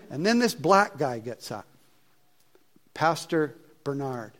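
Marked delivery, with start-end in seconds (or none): none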